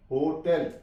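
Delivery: होटल as "ho-TEL", clear E; correct HOTEL vowel. Shown as a demonstration. Teacher repeating the right reading, "ho-TEL". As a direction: end होटल on a full "tel". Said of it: "इसे होटल नहीं आपने पढ़ना".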